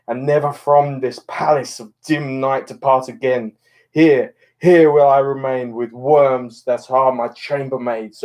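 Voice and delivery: impassioned, declaiming Romeo's dying speech; dramatic Shakespeare reading. Acted. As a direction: mourn over Juliet as you speak the verse.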